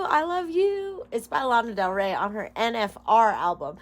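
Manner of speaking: ordinary talk, nothing marked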